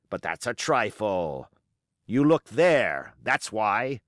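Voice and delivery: a scornful pirate captain holding his ground; defiant old sea-cook pirate. Acted. Pirate cook rebutting the mutineers' charges, defiant. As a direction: roll the words out like a seasoned buccaneer defending his command. Silver's speech continues.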